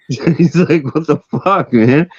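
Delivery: high voice full of laughter; laughing. Real, not acted. Storyteller quoting the blown-up skier, laughing. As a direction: squeeze the quote out through laughs.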